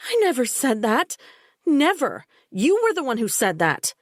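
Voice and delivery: high, loud denial, stress on Never; indignant protest; heated denial. A narrator's reading. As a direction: fling the accusation back.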